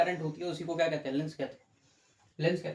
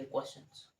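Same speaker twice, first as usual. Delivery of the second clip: whispered repeat; 3 semitones lower, 6 dB quieter than usual. Shown as a demonstration. Teacher echoes "questions" to students, whispered. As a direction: whisper "questions" softly as an echo.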